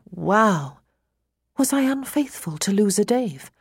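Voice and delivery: ordinary delivery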